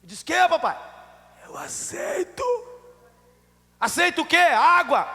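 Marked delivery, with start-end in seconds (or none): 0.2-0.8 high, loud, urgent question; raised voice, pressing for a repeat; loud asking. Retold or imitated. Retells straining to hear father. 1.4-2.4 imitated voiceless, strained whisper; hoarse whisper of a feeble patient; speaking low. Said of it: "tava falando baixo". 3.8-5.1 high, loud, baffled questioning; puzzled loud question. Retold or imitated. Retells puzzled son asking.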